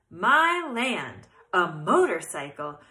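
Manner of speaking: put-on loud old aunt exclaiming; second try. Shown as a demonstration. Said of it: "let me read that again".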